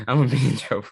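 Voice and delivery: laughing, high and fast. Real, not acted. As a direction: repeat it through a laugh. Laughing repeat of own line.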